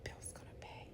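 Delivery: very soft, breathy threat; menacing whispered threat; vengeful whisper. Retold or imitated. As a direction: whisper it like a quiet vow of revenge. Danny vows revenge.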